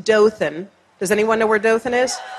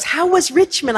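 higher, bright motherly question; mom asking; 8 semitones higher than usual. Retold or imitated. Mom on the phone asking about the interview, lightly voiced higher.

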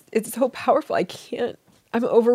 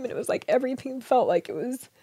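ordinary talk, nothing marked

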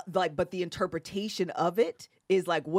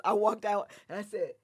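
normal talking voice

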